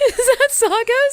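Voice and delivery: can't believe it's his; drawn-out high surprised ask; high surprised question. Real, not acted. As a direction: squeak of disbelief, high and slow. Surprised at who sent it.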